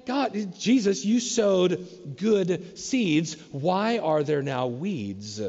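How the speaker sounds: slow, puzzled appeal to God; puzzled plea. Retold or imitated. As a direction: draw each word out in bewilderment. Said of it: "Good things are growing".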